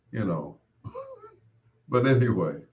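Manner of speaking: ordinary talk, nothing marked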